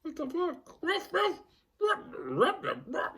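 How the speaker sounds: very high squeaky dog bark. Retold or imitated. Her dog seeing a ghost, barking very high. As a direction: yap like a startled little dog. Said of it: "her dog's like".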